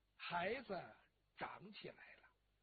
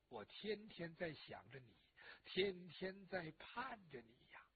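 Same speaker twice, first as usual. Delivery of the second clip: ordinary talk, nothing marked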